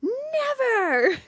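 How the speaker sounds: high cried-out refusal; hammed-up wailing never; yelling back. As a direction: shriek it back at him in mock defiance. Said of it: "And Lily yells back".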